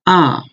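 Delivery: ah falling sharply from high; fourth tone ah. Shown as a demonstration. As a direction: drop ah hard from the top. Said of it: "The fourth one is ah".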